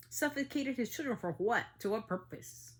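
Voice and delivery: real angry challenge; furious demand. Real, not acted. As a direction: throw the question at him in fury. Angry rant over child killings.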